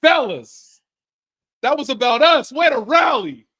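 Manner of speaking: loud rah-rah team talk; rally the fellas loudly; a hammed-up captain rallying teammates in the locker room, loud; mock locker-room speech. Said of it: "take us into the locker room".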